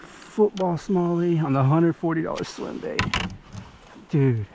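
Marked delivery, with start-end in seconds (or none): none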